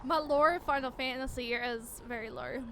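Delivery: ordinary delivery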